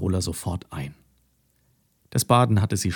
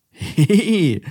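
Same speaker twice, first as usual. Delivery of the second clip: higher, louder short giggle; 9 semitones higher, 6 dB louder than usual. A narrator's reading. Mathilda gets splashed by Carola, a giggle. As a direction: giggle at the wet cow. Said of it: "lachte Mathilda".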